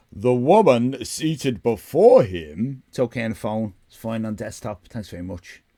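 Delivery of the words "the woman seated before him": slow, dramatic narration; story narration. A narrator's reading. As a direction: read it like a noir novel.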